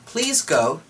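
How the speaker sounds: an exasperated plea to her son; mother pleading. Acted.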